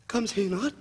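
panicked. Acted. Repeated in rising panic.

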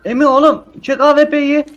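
high, loud excited shout. Real, not acted. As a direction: yell urgently at a teammate. Urgent call during firefight.

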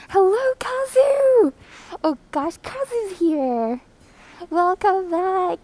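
high, slow, delighted hello; excited greeting. Real, not acted. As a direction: sing out a happy welcome. Streamer greets returning viewer.

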